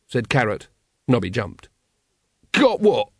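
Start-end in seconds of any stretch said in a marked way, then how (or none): 2.5-3.1 loud, high jumpy shout; startled shout. Acted. Startled Nobby shouts at Carrot, loud and high. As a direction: jump and shout it. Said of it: "he shouted".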